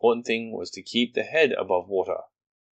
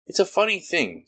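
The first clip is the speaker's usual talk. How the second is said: higher, fussy little Rabbit voice; brisk Rabbit, higher pitched; 11 semitones higher, 4 dB louder than usual. Acted. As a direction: play Rabbit puzzled and busy. Rabbit's voice in story.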